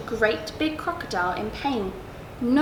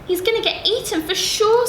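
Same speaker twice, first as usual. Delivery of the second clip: iguana voice; 8 semitones higher, 3 dB louder than usual. Acted. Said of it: "said the iguana".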